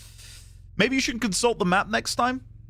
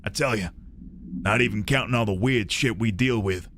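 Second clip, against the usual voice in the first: mock American accent; 9 semitones lower than usual. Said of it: "let me do my best American accent".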